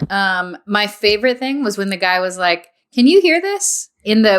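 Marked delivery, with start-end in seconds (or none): 2.9-3.9 lightly imitated call, pitched high; mock calling out. Co-host quoting the guy at the giant ear, calling out. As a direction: check if they can hear, voice lifted. Retold contestant checking he's heard.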